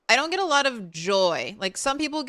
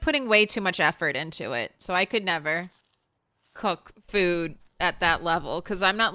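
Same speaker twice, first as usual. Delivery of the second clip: normal talking voice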